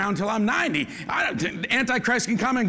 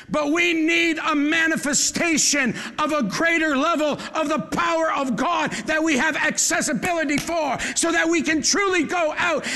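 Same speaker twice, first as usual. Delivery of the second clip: preaching; 6 semitones higher than usual. Acted. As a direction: drive it home to the crowd. Preaching climax.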